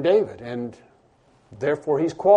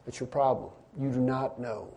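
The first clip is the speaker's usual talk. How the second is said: ordinary talk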